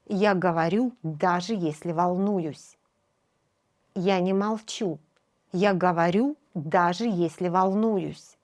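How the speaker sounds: ordinary delivery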